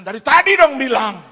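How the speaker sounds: high, loud retold complaint; exasperated shout. Pastor to driver over failed brakes, exasperated shout. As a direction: scold the driver at the top of your voice.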